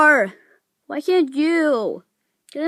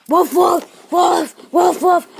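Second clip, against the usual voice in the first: barking dog; 3 semitones higher, 4 dB louder, slower than usual. Acted. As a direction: bark like an attacking dog. Plays attacking dog.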